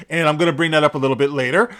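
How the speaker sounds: usual speaking voice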